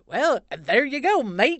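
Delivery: loud, high, drawn-out fake Australian; mock Australian accent. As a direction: badly imitate an Australian, pushed up and loud. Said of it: "He starts talking like an Australian".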